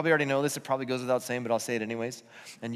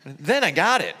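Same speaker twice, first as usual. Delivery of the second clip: a louder, hammed-up child's eureka; kid's mock epiphany; 2 semitones higher, 7 dB louder than usual. To dad, kid's mock lightbulb moment. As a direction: play the kid finally getting it after the yelling. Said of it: "been like".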